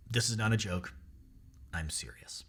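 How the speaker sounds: low, hushed boss's line; dead serious. Retold or imitated. Boss answering his disbelieving employee over dinner, dead serious. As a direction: drop it low and soft, nearly to a whisper. Boss's deadpan reply, retold.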